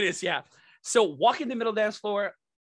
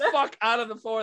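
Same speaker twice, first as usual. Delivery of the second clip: ordinary delivery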